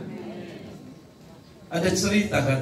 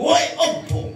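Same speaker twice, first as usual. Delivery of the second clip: mocking call; 8 semitones higher, slower than usual. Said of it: "dia panggil".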